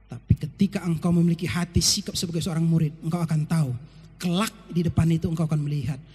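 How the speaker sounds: ordinary delivery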